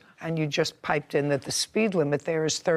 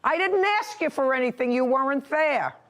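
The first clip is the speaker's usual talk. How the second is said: angry; 8 semitones higher than usual. Real, not acted. Judge scolds interrupting litigant.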